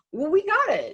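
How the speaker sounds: high, lightly voiced confident kid; a hint of a child voice; kid's voice. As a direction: mimic the kids brushing off help. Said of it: "they say".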